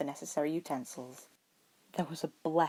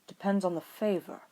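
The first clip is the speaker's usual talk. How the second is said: dry reply; 3 semitones higher than usual. A narrator's reading. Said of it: "Roy responded dryly".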